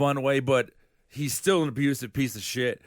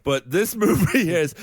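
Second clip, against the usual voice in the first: ordinary delivery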